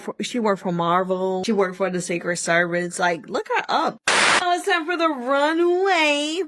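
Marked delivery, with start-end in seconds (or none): 4.4-6.4 show announcer. Pretend. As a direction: announce the runway, stretching out the last word. Announcing the runway segment.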